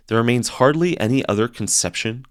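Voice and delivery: ordinary talk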